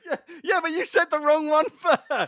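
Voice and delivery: very high, loud protest; heated objection. Real, not acted. Quizmaster disputing a contestant's second answer, loud protest. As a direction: shout the objection over the contestant. Quizmaster arguing over the point.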